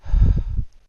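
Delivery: loud weary sigh. A narrator's reading. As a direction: breathe out, fed up. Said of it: "The woman sighs".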